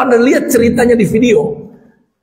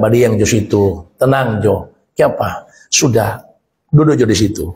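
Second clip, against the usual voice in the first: father hushing son; 14 semitones lower than usual. Acted. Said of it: "Dia bilang".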